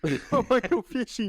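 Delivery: high, fast goofy voice; mock him like a clueless kid; mocking dopey voice. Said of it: "That's how he talks".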